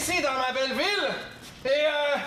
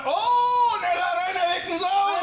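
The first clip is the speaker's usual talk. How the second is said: excited shout; 4 semitones higher, 3 dB louder than usual. Acted. Excited mayor greeting.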